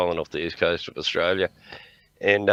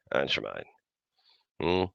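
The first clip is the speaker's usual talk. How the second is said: usual speaking voice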